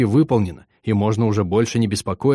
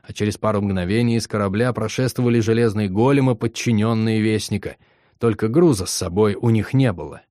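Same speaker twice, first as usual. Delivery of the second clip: plain reading voice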